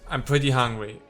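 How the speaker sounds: usual speaking voice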